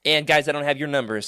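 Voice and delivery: ordinary delivery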